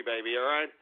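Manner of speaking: winded and gasping; breathless, sighing; out of breath. Real, not acted. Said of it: "a little winded here".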